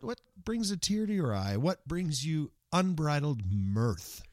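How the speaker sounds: ordinary delivery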